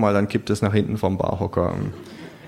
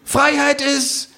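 much higher, drawn-out kangaroo call; proclaiming kangaroo; 3 dB louder, slower than usual. Acted. Kangaroo on the bar counter proclaiming freedom.

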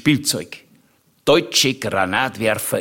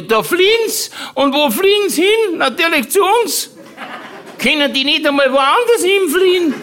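put-on much higher, fast indignant old aunt; Aunt Mari's voice; 3 dB louder than usual. Aunt Mari complaining about refugees, high and indignant. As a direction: squeak it out like a fed-up old aunt. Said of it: "weil die Tante Mari gesagt hatte".

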